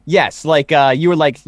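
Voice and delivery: ordinary talk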